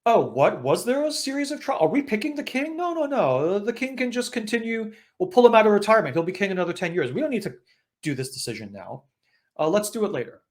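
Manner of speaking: high put-on voice, brushing off the decision; evasive brothers. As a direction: stall like a sly brother ducking a duty. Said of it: "the brothers are like".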